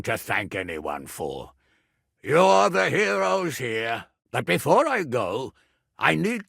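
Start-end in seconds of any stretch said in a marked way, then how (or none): 2.2-4.1 slow, raised call to the crowd; rousing praise. Acted. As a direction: hail them as heroes, slowly. Praising defenders after battle.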